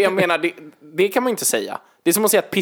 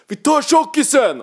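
high, loud, slow shout; mock gang shout; 11 semitones higher, 4 dB louder, slower than usual. Pretend attackers charge the sumo wrestler, mock shout. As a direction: yell like a thug gang.

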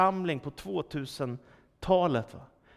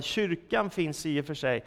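ordinary delivery

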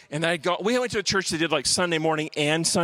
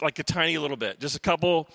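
usual speaking voice

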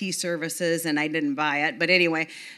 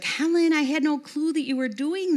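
lightly voiced higher woman, eager; Yvonne's voice; 9 semitones higher than usual. Old church friend surprised to see Helen, voiced higher. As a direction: mimic her surprised, higher pitch. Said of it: "And she's like".

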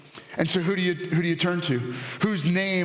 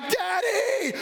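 put-on high child's cry of Daddy; kids calling Daddy; 13 semitones higher than usual. Kids crying out for dad at night, high childlike call. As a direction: call out like a scared little kid. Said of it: "They're like".

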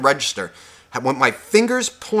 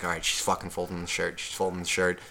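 low, much softer grumble under the breath; muttering to himself; 7 semitones lower, 11 dB quieter than usual. Retold or imitated. Watching the cashier fold, he mutters low under his breath. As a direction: mutter it low so she won't hear.

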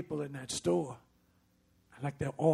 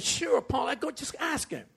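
big sister; 10 semitones higher than usual. Retold or imitated. Older sister encouraging little sister.

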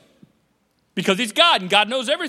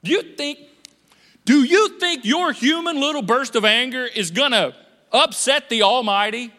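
usual speaking voice

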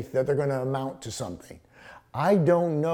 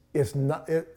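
normal talking voice

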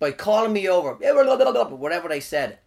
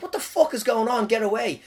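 mock outburst; 6 semitones higher than usual. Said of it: "and he lost it".

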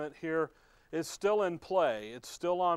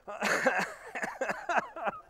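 coughing fit; 12 semitones higher than usual. Real, not acted. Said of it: "See, I even got him going".

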